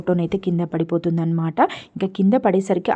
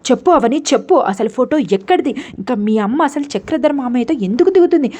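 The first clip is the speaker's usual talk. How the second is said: loud, high furious demand; retold angry yell at Avani; angry shouting; 5 semitones higher, 4 dB louder than usual. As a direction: yell at her to answer. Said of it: "చాలా గట్టి గట్టిగా అరిచేసరికి".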